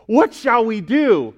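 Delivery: slow, mock-desperate plea; acted desperation. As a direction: plead as a crowd at a loss. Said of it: "desperation. You know what they say?".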